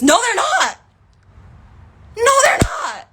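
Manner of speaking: high, loud yell; furious rebuttal. Real, not acted. Rebutting commenters who generalize, yelling furiously. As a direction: scream the denial in exasperation.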